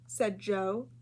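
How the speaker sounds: plain reading voice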